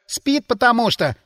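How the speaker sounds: put-on high-pitched boyish blurt; boy's squeak. A narrator's reading. Boy eagerly tells on a sleeper, squeaking. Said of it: "пискнул пацан".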